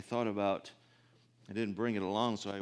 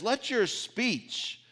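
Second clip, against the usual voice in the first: slow, high, solemn Bible reading; scripture reading; 13 semitones higher, 6 dB louder, much slower than usual. Acted. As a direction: read the verse out slowly to the flock. Reads scripture aloud.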